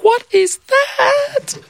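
high, drawn-out frightened squeal with a laugh; startled girl hearing a voice in a storm; startled cry. A narrator's reading. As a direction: jump at a voice in the dark.

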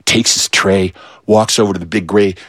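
ordinary delivery, nothing marked

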